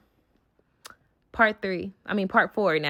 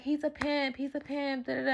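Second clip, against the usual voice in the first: mock soft confession; 5 semitones higher, 8 dB quieter than usual. Mimicking the friend's confession.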